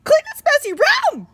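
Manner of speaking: very high, loud yelp; mother yelping the order. Shown as a demonstration. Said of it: "yelped Mother".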